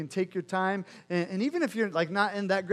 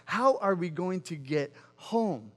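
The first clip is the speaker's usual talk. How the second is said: slow, spaced-out retold question; fixated, deliberate; slower than usual. Son fixated on getting off the cliff, slow and deliberate. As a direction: spell out each word of the question slowly. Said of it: "he just became fixated on".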